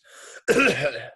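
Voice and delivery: loud forced throat clear. Acted. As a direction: try to cough a voice back out. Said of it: "Mac coughed".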